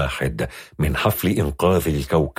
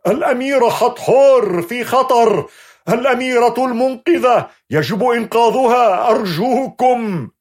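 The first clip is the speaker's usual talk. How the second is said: high, loud pleading shout; shouting at full voice; 4 dB louder than usual. Acted. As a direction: scream it, breaking into a cry. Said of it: "ويصرخ بأعلى صوته".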